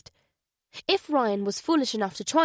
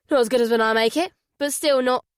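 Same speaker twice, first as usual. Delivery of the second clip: teasing; 3 semitones higher, 5 dB louder, faster than usual. Acted. Father joking, fast.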